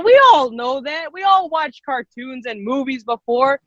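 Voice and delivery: ordinary delivery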